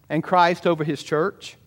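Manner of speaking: ordinary talk, nothing marked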